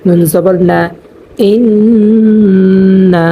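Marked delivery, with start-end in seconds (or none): 1.5-3.3 ইন্না with a long held nasal on the doubled n; ইন্না chanted slowly with the nasal sound held; ghunnah demo. Shown as a demonstration. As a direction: hum the nn of ইন্না through the nose before the a. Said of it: "এখানে গুণনা হবে".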